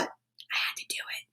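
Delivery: whispered confession; hushed admission; confiding whisper. Real, not acted. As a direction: let them in on a secret. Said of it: "but guess what?".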